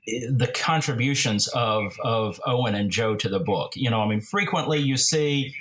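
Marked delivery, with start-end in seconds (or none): none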